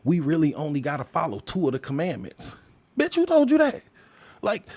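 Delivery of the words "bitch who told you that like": incredulous retort. Real, not acted. Answering a claim about two commandments, high incredulous snap. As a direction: fire back in total disbelief.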